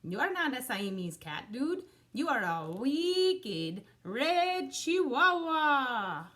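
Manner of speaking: lightly voiced Spanish accent, slowing at the end; a hint of a Spanish-accented kitty. A narrator's reading. Kitty boy to the dog in the mirror, light Spanish accent. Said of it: "using his very best Spanish accent".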